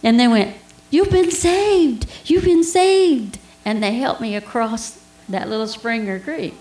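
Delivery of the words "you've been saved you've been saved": high, loud, drawn-out exclamation; imitated boys' cheer. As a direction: proclaim it slowly in a much higher voice. Imitating boys calling out to her.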